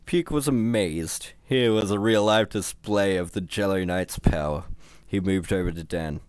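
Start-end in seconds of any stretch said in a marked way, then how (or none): none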